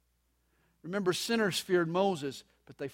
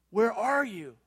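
calling out; 4 semitones higher than usual. Acted. God calling out to Adam.

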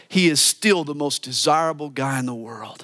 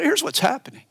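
normal talking voice

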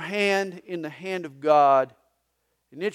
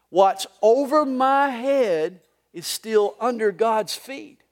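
slow, louder call-and-response line; said together; 5 semitones higher, 8 dB louder, slower than usual. Shown as a demonstration. Pastor leading the congregation in a slow refrain. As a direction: lead the room through it slowly. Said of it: "Would you say it with me?".

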